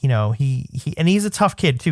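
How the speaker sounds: usual speaking voice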